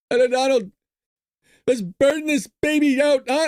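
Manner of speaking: high-pitched, badly faked Saudi accent; mock Saudi accent. Host voicing Saudi prince in golf cart, mock Saudi accent. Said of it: "how would you do a Saudi Arabia accent".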